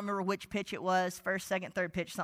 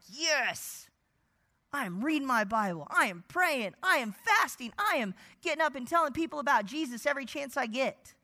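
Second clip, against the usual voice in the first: overconfident; 5 semitones higher than usual. Retold or imitated. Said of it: "We get so confident, like".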